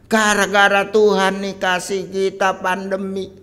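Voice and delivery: loud, high grumbling at God; hammed-up angry protest; mock angry complaint. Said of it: "Jangan marah-marah kepada Tuhan".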